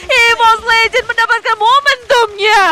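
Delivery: high, loud frantic shout-casting; fighting game announcer. Real, not acted. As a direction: yell the teamfight play by play. Casters call a teamfight. (caster berteriak heboh saat teamfight)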